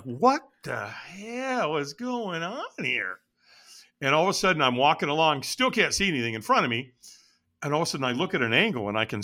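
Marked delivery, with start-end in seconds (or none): 0.0-3.2 high, drawn-out disbelief; slow, incredulous exclamation; retold bewilderment. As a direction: stretch every word in baffled amazement. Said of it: "And I'm like".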